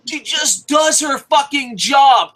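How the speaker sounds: loud slow emphatic rant; a slow shouted rant, hammering each word. Real, not acted. Yelling praise of the ring announcer at viewers, loud and slow.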